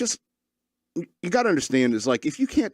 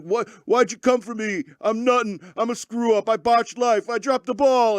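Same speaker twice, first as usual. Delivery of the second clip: high, louder whiny mock sob; mock crying; 11 semitones higher, 4 dB louder than usual. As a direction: ham up a teary breakdown of a screw-up kid. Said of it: "and he's crying".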